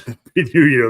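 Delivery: amused, laugh breaking into the words; laughing at oneself. Real, not acted. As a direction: read it out through a laugh. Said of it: "That's why I'm laughing at myself".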